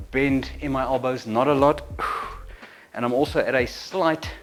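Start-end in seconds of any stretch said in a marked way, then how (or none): 1.9-2.6 short controlled puff of breath; effort exhale. Shown as a demonstration. Trainer breathing through a raise, effort exhale. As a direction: breathe out hard through the mouth. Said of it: "and out".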